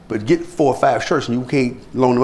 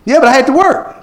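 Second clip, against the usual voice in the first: high, loud, protesting excuse; mock defensive excuse; 12 semitones higher, 6 dB louder than usual. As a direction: play someone insisting on their excuse. Acted excuse in same quarrel.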